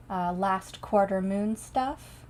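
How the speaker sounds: normal talking voice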